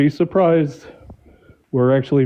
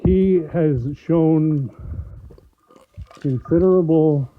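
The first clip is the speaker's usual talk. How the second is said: muffled, mic covered by hand; finger over the mic; 3 semitones higher, slower than usual. Real, not acted. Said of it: "You're covering your mic".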